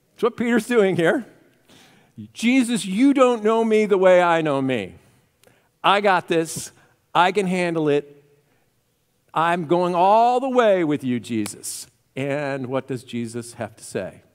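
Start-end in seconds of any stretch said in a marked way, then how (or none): 2.3-11.9 loud, cocky protest; talking back. Acted. Peter talking back to Jesus, loud and overconfident. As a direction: push back hard, sure of yourself. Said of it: "Can you imagine talking back to God?".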